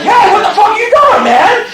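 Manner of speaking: high, loud, fast squawk; outraged protest. Acted. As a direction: yell like hammed-up panicked little voices. His balls protest in uproar.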